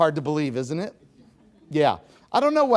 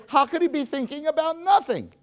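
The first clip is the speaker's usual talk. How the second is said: wife's disbelief; 13 semitones higher, 3 dB louder than usual. Retold or imitated. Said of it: "you say".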